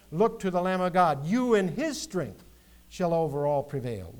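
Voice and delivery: high, loud preaching voice; spoken hymn, declaimed from the pulpit; fervent hymn recitation. Acted. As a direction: proclaim the hymn lines as a rallying call. Recites hymn at full force.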